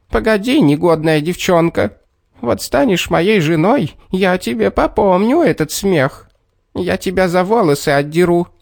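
high, piping angry shout; squeaky angry little man. A narrator's reading. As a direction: threaten in a tiny squeaky voice. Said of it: "закричал тоненьким писклявым голоском".

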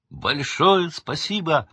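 high, loud, drawn-out thanks; calling out. A narrator's reading. Harry thanking the elves on leaving, calling out. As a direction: call it across the room. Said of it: "крикнул Гарри эльфам".

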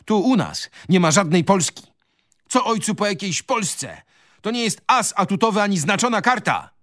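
very high, defiant outburst; heated crying out. A narrator's reading. Son defying his father over Poland, crying out. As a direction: fire it back with pitch way up. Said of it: "krzyknął Adaś".

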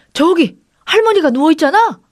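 loud, high-pitched shout. A narrator's reading. Husband spots grandmother lying on the grass, shouting. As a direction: cry out at a sudden sight. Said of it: "남편이 먼저 소리쳤습니다".

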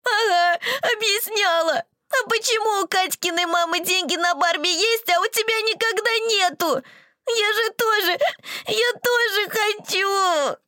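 high, wailing tearful accusation; crying child yelling. Acted. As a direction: shriek it through sobs. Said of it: "выкрикивать сквозь рёв".

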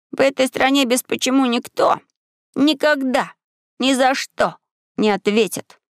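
put-on high boyish scorn with pauses; high, disdainful, emphatic delivery; dismissive. A narrator's reading. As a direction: set each word apart with disdain. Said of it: "сказал он пренебрежительно".

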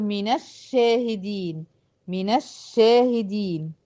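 slow "من الشاهدين" as mina-shshahidin; measured tajweed example, "من الشاهدين" joined by a; demonstrating the fatha link. Shown as a demonstration. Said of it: "هقول".

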